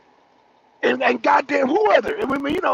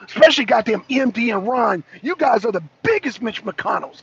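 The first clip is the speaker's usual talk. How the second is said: usual speaking voice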